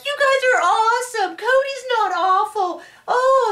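high, gushing fake-cheerful voice; mocking gushing students. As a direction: parody naive students raving. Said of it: "they're like".

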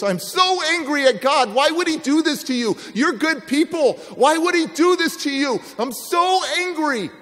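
high, loud angry rant at God; angry outburst. Retold or imitated. Driving with the husband, ranting at God in loud anger. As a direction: vent the fury, loud and high. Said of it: "and I say this".

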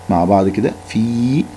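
ordinary talk, nothing marked

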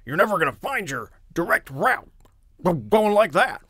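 high, loud barking bark of a gruff racer; loud barking coach scolding his rider. Shown as a demonstration. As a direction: bark orders at Racine. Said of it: "so he's kinda barking".

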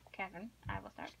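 unvoiced hush before starting; breathy stage whisper, playful. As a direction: whisper it like a secret announcement. Playful formal start to reading.

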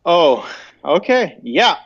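normal talking voice

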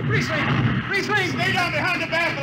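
high, strained and urgent. Acted. Crewman handles the hot tube, strained.

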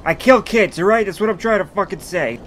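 exasperated outburst. Pretend. Exasperated outburst after rambling song.

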